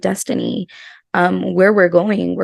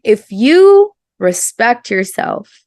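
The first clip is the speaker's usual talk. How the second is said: ordinary delivery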